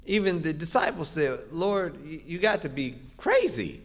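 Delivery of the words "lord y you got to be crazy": lightly acted incredulous disciple, pitched higher; mock incredulity. Disciples questioning Jesus about who touched him, mildly incredulous.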